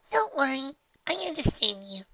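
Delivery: put-on bright high young filly. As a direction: comfort a friend, high-pitched. Dinky voice.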